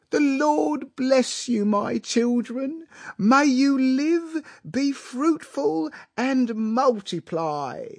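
very high, slow, hammed-up blessing; tearful blessing. A narrator's reading. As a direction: intone it grandly, as if overcome with tears. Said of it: "lifting up his arms and blinking tearfully".

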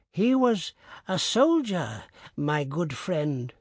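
put-on slow, frail old man; weak voice. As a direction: answer feebly, slowly. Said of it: "the old man answered in a weak voice".

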